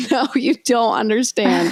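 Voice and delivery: mock urgent protest. Passengers to crew about a tight connection, mock urgent protest. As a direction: plead it as a retold protest. Acted panicked reply to flight crew.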